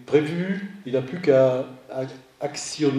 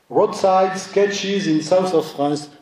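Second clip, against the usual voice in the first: slow, higher English title in a French accent; 4 semitones higher, much slower than usual. Shown as a demonstration. Lecturer reading an English book title in a French accent. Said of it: "Vous excuserez mon accent anglais".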